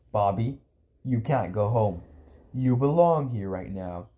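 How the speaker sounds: low, measured uncle voice; grave uncle. Acted. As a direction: deliver hard news low and evenly. Uncle gently breaks bad news.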